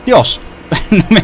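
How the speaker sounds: high, loud startled cry; startled shout. Real, not acted. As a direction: jump and cry out. Sudden scare in the game.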